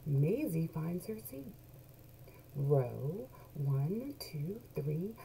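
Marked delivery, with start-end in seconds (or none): none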